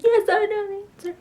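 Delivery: very high, loud mock know-it-all; overeager student. Acting out answering the teacher confidently, overeager student. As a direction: squeal it like the keenest pupil in class.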